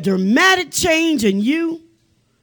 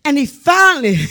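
normal talking voice

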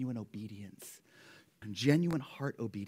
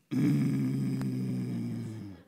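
put-on deep childish growl of protest; low grudging growl; 11 semitones lower than usual. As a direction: growl it like a sulky toddler. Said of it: "He just growls".

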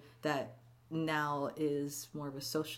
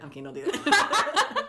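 laughing; 10 semitones higher, 7 dB louder than usual. Real, not acted. Laughing while speaking.

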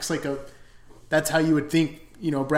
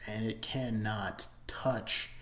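slow, whispered, much softer; hushed emphasis; 4 semitones lower, 8 dB quieter, much slower than usual. Real, not acted. Reviewer downplaying the seventies version, slow whisper. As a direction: breathe it out slowly for weight. Dismissing the older remake.

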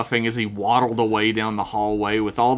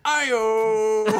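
shout; 10 semitones higher than usual. Acted. Greaser calling out.

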